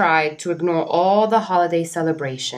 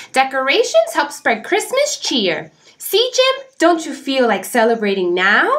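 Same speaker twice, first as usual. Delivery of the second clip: cheerful bird voice; 8 semitones higher than usual. Acted. Oxpecker coaxing Jim.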